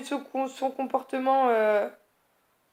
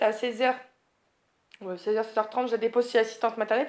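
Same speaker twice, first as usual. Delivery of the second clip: ordinary talk, nothing marked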